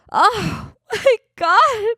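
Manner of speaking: high, drawn-out sarcastic cooing; hammed-up doting parent, squeaky and slow; mock gushing. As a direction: gush slowly over the baby, laughing through it. Mocks doting on a newborn.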